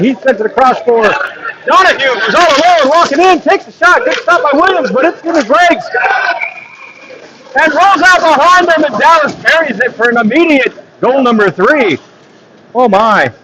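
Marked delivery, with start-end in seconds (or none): 0.0-11.2 rising goal call. Acted. Commentator calling Dallas goal for viewers, excited rising call. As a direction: build the call as the shot goes in.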